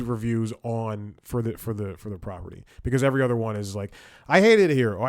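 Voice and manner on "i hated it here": loud, higher-pitched imitation of a reviewer; mock emphatic complaint. Mimicking every other review of the property, mock emphatic complaint. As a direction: exaggerate it like an over-the-top online rant.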